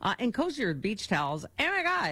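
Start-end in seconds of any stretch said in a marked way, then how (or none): 1.6-2.1 high, delighted gush. Real, not acted. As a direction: swoon over the towels. Gushing over towels, very high.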